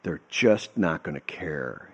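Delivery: soft, slow resigned voice; sighing resignation. Retold or imitated. Mentor sighing the hard truth to his protégé, softly. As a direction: exhale it like a weary sigh. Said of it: "he just sighs like that".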